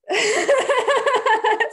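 a high, loud burst of laughter; high real laughter. Real, not acted. Laughing at the mishap.

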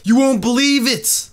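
high, hyped-up fake amazement; mock hype title. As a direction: sell it like clickbait. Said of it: "put, like".

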